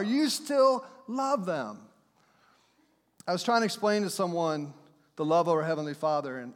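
ordinary delivery

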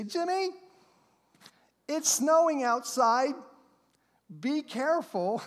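lightly voiced fussing mother; a hint of a worried mom warning her son; gently mimicked mother; imitating mom. Preacher imitates his mom.

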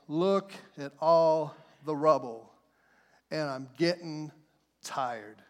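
slow, low, tired complaint; weary workers. Acted. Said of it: "the people say".

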